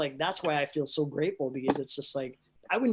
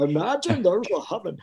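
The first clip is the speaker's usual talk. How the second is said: loud, goofy cartoon voice; big, hammed-up cartoon impression; Ed from Ed, Edd n Eddy; 3 semitones higher, 5 dB louder than usual. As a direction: belt out the line as a dopey cartoon character. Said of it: "didn't do it in Ed and Eddie's voice".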